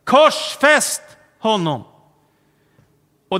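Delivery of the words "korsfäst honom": higher, much louder slow shout of "Korsfäst"; slow full-voiced shout of "Korsfäst honom"; crowd shouting louder. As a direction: yell it as the crowd escalating, "Korsfäst" hit hard. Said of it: "ropar folket ännu högre".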